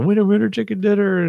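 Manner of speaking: high-pitched mock victory cheer; retold celebratory yell. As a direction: holler it like a jackpot win, held back a little. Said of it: "We roll the yell".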